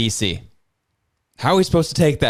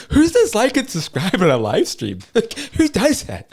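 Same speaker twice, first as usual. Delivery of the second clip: higher voice breaking into laughter; incredulous laughing; 10 semitones higher than usual. Real, not acted. Host ridiculing the fake-video claim, laughing incredulously. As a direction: laugh through the disbelief.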